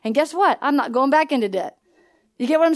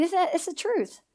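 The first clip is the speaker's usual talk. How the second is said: ordinary talk, nothing marked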